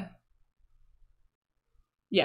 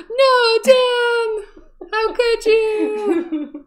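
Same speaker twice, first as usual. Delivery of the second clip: high, loud hammed-up wail of betrayal; mock anguished cry; 9 dB louder, much slower than usual. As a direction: wail it like a melodrama heroine, much higher and louder. Mock betrayal at sister's laugh.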